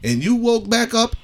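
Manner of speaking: hammed-up disbelief at the idea; incredulous rant. Husband ranting at his wife about surgery, incredulous.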